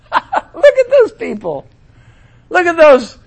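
high, laughing scoff; mock derision; laughing mockery. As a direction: point and laugh at them. Mocking Jericho marchers.

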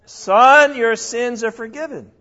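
Son called out loud and high, then the rest declared; loud emphatic declaration. Shown as a demonstration. Preacher to his class, restating Jesus' words with loud force. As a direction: proclaim it so the shock of it lands. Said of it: "Is that his problem?".

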